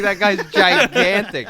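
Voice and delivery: slow, laughing disbelief; laughing amazement. Retold or imitated. Dad laughing at how gigantic the coworker is. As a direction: marvel at it through a laugh.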